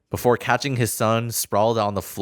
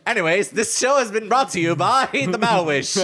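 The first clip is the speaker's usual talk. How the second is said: ad announcer voice; 9 semitones higher than usual. Acted. Mock sponsor read.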